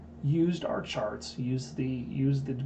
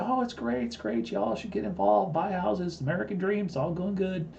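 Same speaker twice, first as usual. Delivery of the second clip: higher, sing-song mock optimism; mocking TV cheer; 7 semitones higher than usual. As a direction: parody a cheerful TV pundit. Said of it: "you listen to C N B C, they're telling you".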